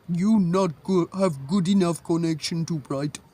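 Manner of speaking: mimicked official repeating herself in a thick foreign accent; halting broken English with a foreign accent. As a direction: imitate a heavy foreign accent, words stumbling. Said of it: "she repeated again and said".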